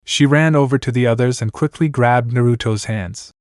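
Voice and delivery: usual speaking voice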